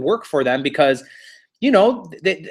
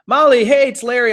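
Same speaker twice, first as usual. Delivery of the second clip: higher, louder eager hello; eager callback; 8 semitones higher, 4 dB louder than usual. Retold or imitated. Larry phones Molly hoping for the listing, higher and louder. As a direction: grab her attention, all keen.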